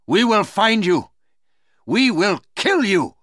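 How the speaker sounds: high, forceful vow of revenge; loud threat. Acted. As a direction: threaten loudly, pitched right up. Uncas threatens Magua.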